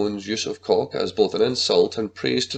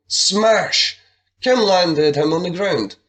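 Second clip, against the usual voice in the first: ordinary delivery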